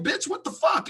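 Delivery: high-pitched retold indignation; indignant confrontation. Confronting the woman who hit her, indignant.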